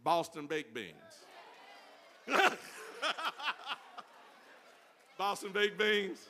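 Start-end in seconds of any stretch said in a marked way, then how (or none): 1.0-4.8 a big high burst of laughter; high loud laugh. Real, not acted. Preacher cracking up at the candy name. 5.2-6.2 slow words through laughter; laughing. Real, not acted. Preacher laughing as he names his candy.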